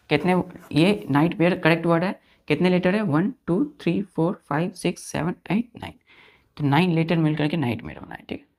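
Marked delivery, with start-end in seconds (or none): none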